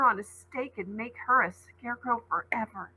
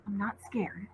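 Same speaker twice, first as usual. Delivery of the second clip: quiet self-reassurance; 11 dB quieter than usual. Acted. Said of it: "Ollie said".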